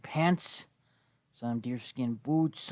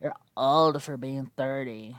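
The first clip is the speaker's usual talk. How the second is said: mocking; slower than usual. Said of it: "people will say stupid shit like".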